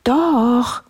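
high insistent contradiction; calling princess. A narrator's reading. Princess answering the bear, high insistent cry. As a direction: call it out high, refusing to give in. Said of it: "rief die Prinzessin".

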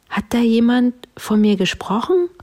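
questioning bear. A narrator's reading. Bear cutting into the talk, high questioning voice. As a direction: ask as a slightly puzzled, high-voiced bear. Bear figure asks in story.

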